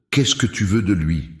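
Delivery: mildly firm challenge; a touch of defiance, narrated; firmer voice. A narrator's reading. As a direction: confront the entity with understated firmness. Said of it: "d'une voix plus ferme".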